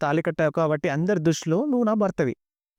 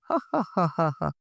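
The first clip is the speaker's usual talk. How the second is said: acted string of ha-ha-has; hammed-up teasing laugh; mocking laugh; 3 dB quieter, much slower than usual. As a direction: laugh at a silly claim, put on. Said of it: "అని ధీరజ్ అయితే నవ్వుతూ ఉంటాడు".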